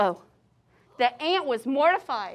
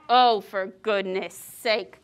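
lower, slow exasperated curse; cursing; 7 semitones lower, slower than usual. Acted. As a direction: grumble it out, fed up. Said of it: "cursed the elephant".

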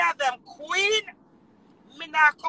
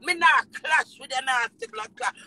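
normal talking voice

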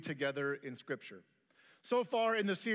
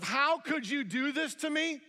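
betrayed plea; 6 semitones higher, 4 dB louder than usual. Retold or imitated. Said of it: "a look of".

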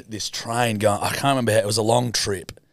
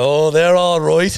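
slow, louder put-on Irish accent; fake Irish accent; 6 semitones higher, 6 dB louder, slower than usual. Teen answers the trolley lady in mock Irish accent. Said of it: "And I go".

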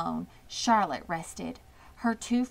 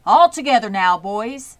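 loud call to the men; rally the boys to lift; shouted instruction to lift together; calling to helpers; 4 semitones higher, 13 dB louder than usual. A narrator's reading. Mister Zuckerman directing men.

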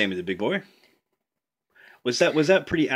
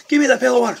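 high, louder, laughing as he grabs; laughing tug of war; 8 semitones higher, 6 dB louder, much faster than usual. Acted. Jack fights over the pail, laughing high and loud. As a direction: giggle through the demand.

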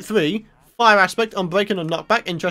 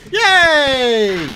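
loud delighted cheer; cheering yay; 4 semitones higher, 6 dB louder than usual. Real, not acted. Gamer cheering a good drop, loud and delighted. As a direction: celebrate it out loud.

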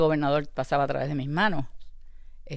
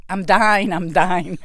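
loud, higher mock cry of labour; retold screaming; 3 semitones higher, 9 dB louder, much slower than usual. Mother re-enacts crying out in English during labour, retold screaming. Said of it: "lo mucho que grité".

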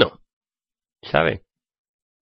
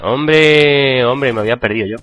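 normal talking voice

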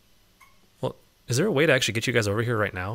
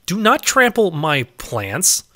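each word slow and firm; slow stern scolding; 6 semitones higher, 3 dB louder, much slower than usual. Real, not acted. Scolding the mobs trampling her plants, slow and stern. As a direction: lay down the law, slowly.

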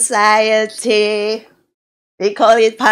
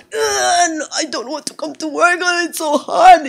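mock whining worker; 7 semitones higher than usual. Worker whining about going to work.

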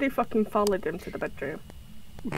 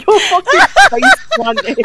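loud laugh through the words; 6 semitones higher, 7 dB louder than usual. Real, not acted. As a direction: crack up mid-sentence. Laughing at friend's face plant.

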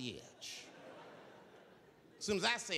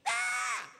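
high battle scream; wild high yell; 5 dB louder than usual. Retold or imitated. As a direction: shriek as you charge. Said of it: "He screamed out".

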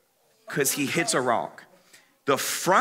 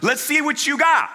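much higher and louder taunt; taunting call; 10 semitones higher, 3 dB louder than usual. Retold or imitated. Hyped friend taunting him loudly from below. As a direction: goad him up the hill. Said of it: "he's like".

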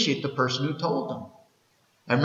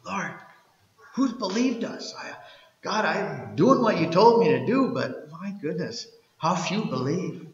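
mock frustrated prayer; 5 semitones higher than usual. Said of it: "A little bit of frustration?".

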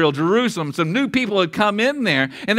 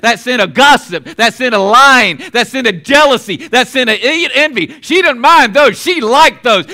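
rising, louder preacher's build; preaching; 4 semitones higher, 6 dB louder than usual. Acted. Preacher driving the point home to the congregation, louder. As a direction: pound it home from the pulpit.

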